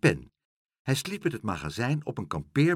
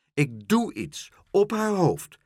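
high, slow gleeful announcement; exclaiming; 9 semitones higher, 4 dB louder than usual. A narrator's reading. Dolly the pigeon revealing her plan, exclaiming. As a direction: proclaim it word by word. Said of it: "riep Dolly".

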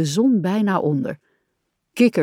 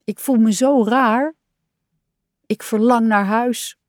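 higher, plaintive little voice; sad; 4 semitones higher than usual. A narrator's reading. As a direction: confess homesickness with mild sorrow. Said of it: "zat Kikker er treurig bij".

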